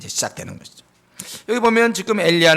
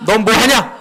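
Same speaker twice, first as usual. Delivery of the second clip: loud, stern self-rebuke, higher and louder; scolding yourself; 5 semitones higher, 7 dB louder, slower than usual. Shown as a demonstration. Preacher showing the church how to scold yourself, loud and stern. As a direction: shake yourself awake with a sharp scold. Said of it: "이러고 막 좀 자기를 책망하세요".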